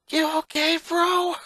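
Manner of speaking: put-on high, caring little-girl voice; tomboy sister voice. Sister hugging her shaken brother after the rescue, concerned voice. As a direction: check on him like a tough kid sister. Lana voice in story.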